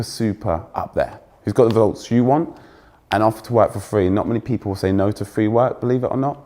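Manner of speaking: normal talking voice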